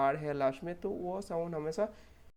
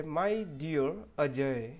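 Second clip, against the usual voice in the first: slow, stretched example phrase; slow example read; much slower than usual. Shown as a demonstration. Teacher slowly reading an example to students. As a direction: draw out dear with a clear glide. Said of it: "जैसे".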